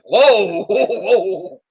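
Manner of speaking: high, loud whoas broken by laughter; retold astonishment, cracking up; big laughing reaction to a savage burn; shocked whoa. Retells his shocked reaction, laughing.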